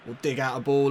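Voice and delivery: normal talking voice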